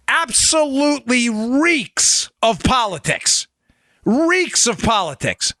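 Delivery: REEKS drawn out slowly in disgust; slow disgusted emphasis. Real, not acted. Host condemning the indictment, slow disgusted stress. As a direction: lean hard on absolutely and reeks, slowly. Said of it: "repeated for emphasis".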